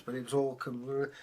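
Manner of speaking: usual speaking voice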